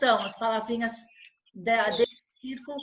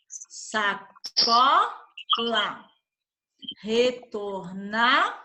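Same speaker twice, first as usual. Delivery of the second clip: slow dictation read; 2 semitones lower, slower than usual. Shown as a demonstration. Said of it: "vocês vão separar essas duas palavrinhas".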